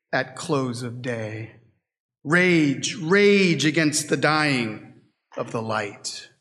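slow, solemn verse, falling low at line ends; poem recitation. Acted. Preacher quoting the dying man's voice in the poem, slow recitation.

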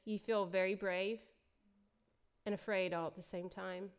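normal talking voice

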